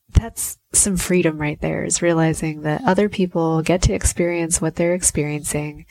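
ordinary talk

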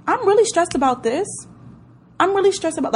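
amused disbelief. Retold or imitated. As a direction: question yourself as if it's absurd. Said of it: "I'll just bust out laughing".

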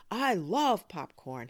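loud, high, drawn-out delight; excited enthusiasm. Acted. Boy hearing about popcorn, loud drawn-out excitement. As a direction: relish it slowly and loudly.

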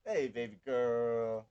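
slow put-on Yiddish accent; lightly voiced Yiddish accent. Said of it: "you should pick a different accent".

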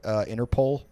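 normal talking voice

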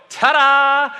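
high, loud showman's ta-da; triumphant ta-da. Retold or imitated. Preacher imagines Jesus returning with a loud, high, triumphant ta-da. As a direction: announce it like a magician revealing the trick. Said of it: "he's gonna come back and go".

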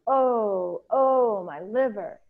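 high, loud "oh"s, drawn out; echoed cry of pain. Acted. Two beings echo Ereshkigal, crying out in pain. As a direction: mirror the queen's pained wail. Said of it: "these two beings cried out".

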